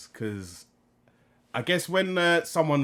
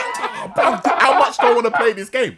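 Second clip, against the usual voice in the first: nervous laughing; 10 semitones higher, 8 dB louder than usual. Retold or imitated. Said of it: "And then you're like".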